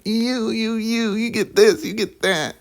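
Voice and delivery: high-pitched, drawn-out mock whine; exaggerated whiny impression with fake blubbering; whiny mock wrestler. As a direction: whine it like a crybaby, dragging the words. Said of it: "very whiny, like".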